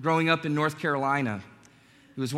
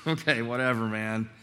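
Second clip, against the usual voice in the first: dismissive; 5 semitones lower, 5 dB quieter than usual. Retold or imitated. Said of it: "they're just kinda laughing like".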